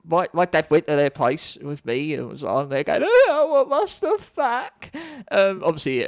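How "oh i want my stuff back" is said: high, drawn-out mock crying wail; put-on whiny accent. Retelling a demand for stolen cards back, mock crying accent. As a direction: wail it like a kid putting on tears. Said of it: "not in that accent".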